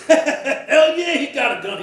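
loud, high laughing mockery. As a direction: laugh it right in his face. Vernon laughs at Kurt.

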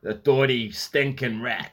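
drawn-out mock insult; put-on character voice; mock villain. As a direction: spit out a hammed-up insult, slowly. Mock gangster-style insult after rat.

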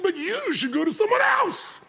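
high, exaggerated sarcastic cry; mock indignation. Father slighted by daughter seeking other advice, mock indignation. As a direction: rise into a hammed-up indignant yell.

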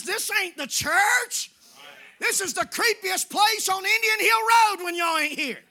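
loud, high preacher's shout, slowing on the key words; full-force preaching. Real, not acted. Pastor telling his congregation they are the church, full-force preaching. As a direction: thunder it from the pulpit.

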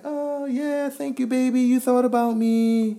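high, drawn-out gushing, kept soft; happy girlfriend imitation. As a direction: mimic a delighted girlfriend, high and stretched out. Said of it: "She's so happy".

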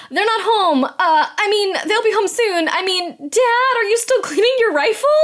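high-pitched mock nervous cover-up; flustered backtracking. Girl bluffing the monster that Dad is home, high mock fluster. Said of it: "she tries to backpack".

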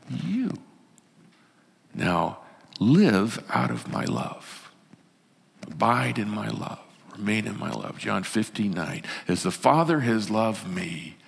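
slow, hushed, low scripture reading; tender reassurance. Acted. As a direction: speak love softly, with long pauses. Tender reading of Jesus' words.

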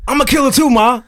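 mock tough teen voice. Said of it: "Tariq was like".

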